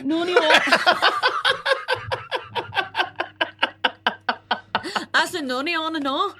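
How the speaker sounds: laughing through a mock Scottish line. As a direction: giggle out the Scottish quote. Laughing repeat of the quote.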